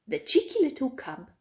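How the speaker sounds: ordinary delivery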